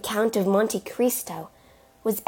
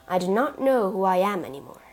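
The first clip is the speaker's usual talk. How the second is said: ordinary delivery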